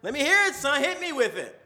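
a dad daring his son to spill it; Dad egging his son on. Retold or imitated. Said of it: "Dad's like".

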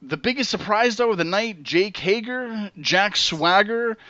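ordinary delivery

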